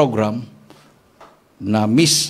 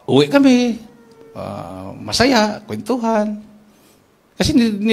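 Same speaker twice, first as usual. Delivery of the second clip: normal talking voice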